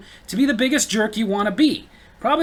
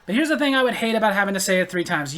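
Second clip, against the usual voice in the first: normal talking voice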